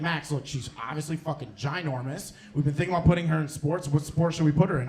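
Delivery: low, soft aunt voice. Retold or imitated. Comedian mimics her aunt asking, in a soft low voice.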